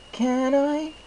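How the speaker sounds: very high, slow, dreamy filly voice; dazed Derpy. Acted. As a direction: say your name slowly as if half-lost. Derpy asks for a muffin.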